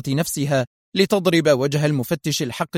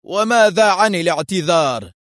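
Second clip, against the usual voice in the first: higher, louder sharp question; sharp demand; 4 semitones higher, 5 dB louder than usual. A narrator's reading. Arthur demands his due from Foster, sharply. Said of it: "قال آرثر في حدة".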